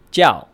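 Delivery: jiao loud, falling sharply from high; fourth tone. Shown as a demonstration. Teacher breaking the question down, jiao in a forceful falling fourth tone. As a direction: hit jiao high and drop it fast. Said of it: "in the fourth tone".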